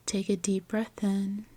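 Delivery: calm meditation guide, long unhurried pauses; guided meditation. Acted.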